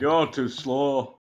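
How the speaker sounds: slow, lightly put-on northern accent; slow northern accent, as a favour. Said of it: "in a northern accent".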